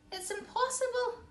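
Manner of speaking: plain reading voice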